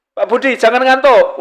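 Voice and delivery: teguran khotib, lebih tinggi; menegur jamaah. Retold or imitated. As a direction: tegur si mengantuk dengan nada tinggi. (menegur jamaah yang mengantuk, nada tinggi)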